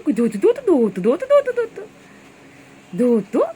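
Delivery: high, loud urging call; loud high run-run chant; excited shout. Real, not acted. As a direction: cheer the bird on loudly.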